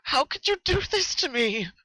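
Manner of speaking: high, broken, tearful voice; sobbing plea. Acted. Rainbow Dash pleading for her life, sobbing. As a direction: beg through sobs. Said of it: "Rainbow sobbed".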